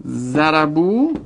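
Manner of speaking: ضربو stretched very slowly; slow demo form. Shown as a demonstration. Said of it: "slow demo conjugation". (ضربو را خیلی کشیده و آرام بگو)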